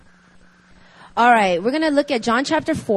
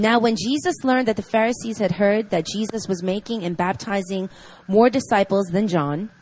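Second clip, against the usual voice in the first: reading scripture; 4 dB louder than usual. Acted. Reads scripture passage aloud.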